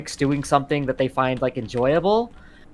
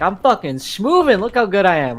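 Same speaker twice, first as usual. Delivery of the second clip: cocky gloating, higher and louder; 7 semitones higher, 4 dB louder than usual. Retold or imitated. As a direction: show off proudly to yourself. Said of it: "you're like".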